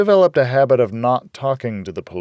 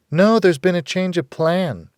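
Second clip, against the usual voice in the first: higher, brisk woman; a hint of Rita, higher; Rita's voice; 9 semitones higher, 4 dB louder than usual. A narrator's reading. Rita telling the girl plans changed, higher.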